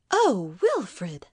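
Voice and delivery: swooping, shocked exclamation; shocked wife. Acted. Wife hears husband uttering blasphemy, shocked. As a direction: exclaim in dismay at his blasphemy.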